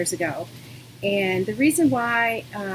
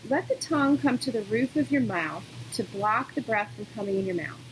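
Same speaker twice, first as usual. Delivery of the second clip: calm, unhurried yoga-teacher voice with long pauses; guided meditation. Real, not acted. Yoga teacher settling students into breathing, calm guidance.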